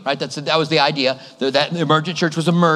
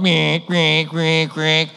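radar beeping; much slower than usual. Retold or imitated. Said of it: "my emergent radar is going".

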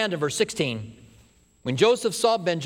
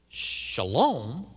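puzzled echo; 4 semitones lower than usual. Acted. Brothers puzzled by the steward's Hebrew greeting, questioning. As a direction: echo the word in disbelief. Brothers doing a double take.

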